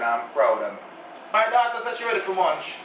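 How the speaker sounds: ordinary talk, nothing marked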